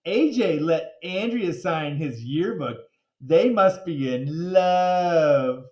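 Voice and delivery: slow sing-song teasing; teasing classmate. Acted. Ryan teasing AJ about Andrea, slow sing-song. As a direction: rub it in slowly. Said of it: "said Ryan".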